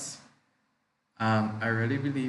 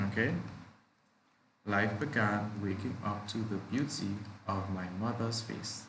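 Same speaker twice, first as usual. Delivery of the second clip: ordinary delivery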